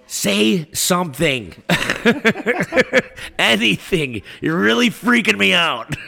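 retold scared plea; nervous pleading. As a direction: beg the figure to answer. Pleading with the silhouette.

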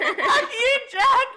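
very high, loud, laughing shout; mock yell.

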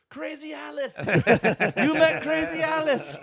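high-pitched crowd chant, drawn out; imitated crowd shout. Crowd answering the comic on stage, mock gleeful shouting. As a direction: holler it like a whole delighted audience. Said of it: "everyone in the crowd goes".

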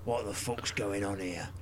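gruff put-on voice; lightly voiced character; impression of actor. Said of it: "That's exactly what he sounds like".

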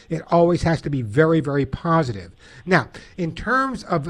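usual speaking voice

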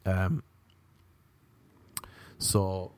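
usual speaking voice

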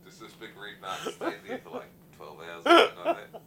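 words breaking up into loud, high laughs; real laughter. Real, not acted. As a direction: laugh your way through the story. Cracking up at own anecdote.

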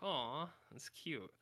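slow, soft cooing over something cute. Retold or imitated. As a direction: coo it slowly, like at a puppy. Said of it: "and you're just like".

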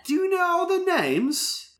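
put-on high, slow British accent; Minnie Driver accent. Skylar testing Will on the names, in a British accent. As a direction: stretch it out high with a British lilt. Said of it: "do it in the Minnie Driver accent".

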